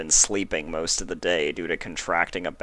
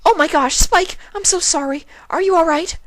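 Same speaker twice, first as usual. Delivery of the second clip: very high, loud, panicked; alarmed apology; 8 dB louder than usual. Acted. Twilight has just hurt Spike, very high loud alarm. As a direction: blurt the apology in shock.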